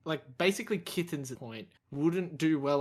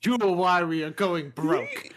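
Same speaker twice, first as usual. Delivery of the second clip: louder mock scolding of the cat; 2 semitones higher, 7 dB louder than usual. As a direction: tell the cat off loudly.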